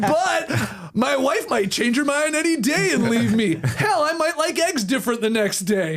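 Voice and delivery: laughing mock quote. Mocking the player's quote.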